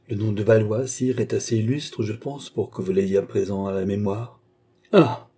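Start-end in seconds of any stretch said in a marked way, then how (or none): 4.9-5.3 burst of laughter. A narrator's reading. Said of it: "avec un bruyant éclat de rire".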